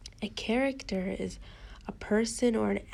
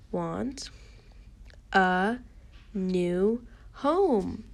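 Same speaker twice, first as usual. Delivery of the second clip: slow, with a pause between words; word-by-word reading; much slower than usual. Shown as a demonstration. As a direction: read it one word at a time for beginners. Teacher models word-by-word reading.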